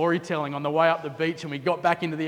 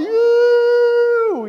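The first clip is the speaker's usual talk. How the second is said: a huge, high, loud "Yoo!" of amazement; stoked surfer hoot; 10 dB louder than usual. Retold or imitated. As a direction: whoop like you've just seen a perfect barrel. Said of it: "we're just going".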